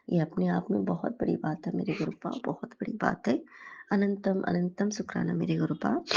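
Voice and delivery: quiet, reverent gratitude; hushed prayer of thanks; devotional thanks. Real, not acted. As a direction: softly thank the guru.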